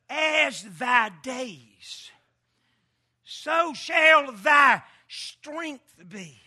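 each word stretched; slow drawn-out refrain. Shown as a demonstration. Preacher repeating the verse slowly to the church. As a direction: let the verse ring slowly. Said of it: "refrain slowed".

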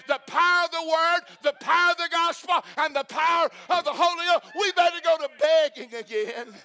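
loud, driving preacher's cry; fiery preaching. Acted. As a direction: hammer it home to the congregation. Preaching at full force.

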